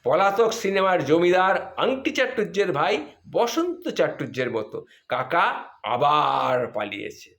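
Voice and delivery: usual speaking voice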